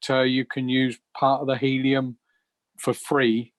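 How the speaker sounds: usual speaking voice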